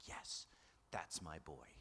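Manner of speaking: soft, whispered pride; proud hushed aside. Retold or imitated. Lakers fan dad murmuring proudly about his son.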